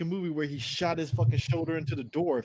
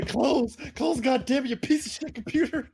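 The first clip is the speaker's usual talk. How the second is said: high, loud, frantic cursing; panicked yelling; 9 semitones higher, 5 dB louder, slower than usual. Retold or imitated. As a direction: yell at the computer in a panic. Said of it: "hitting Alt F four so fucking quick".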